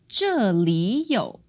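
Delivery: 这里有 with 这 falling and 里 rising; tone change demo. Shown as a demonstration. Teacher shows learners the changed third tone, tone change demo. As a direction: say 这里有 as fourth, second, third tone. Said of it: "it becomes 这, fourth tone, li, second tone".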